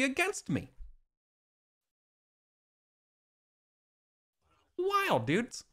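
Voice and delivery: a hint of a chipper storyteller knight; cheery Gawain. Gawain boasting to companions on the road, lightly cheery. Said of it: "in just a chipper, cheery mood".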